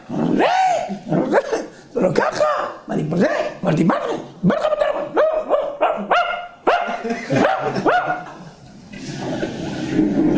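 a husband caught out, scrambling to explain; high, rushed, flustered denials; panicked excuses. Acted. Late husband panics at wife.

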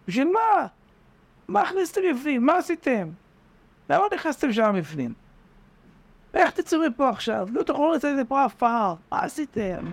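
high, reproachful scolding from above; mock their foolishness from the rim; scolding onlookers. Frogs at the rim scolding the fallen ones, high and reproachful.

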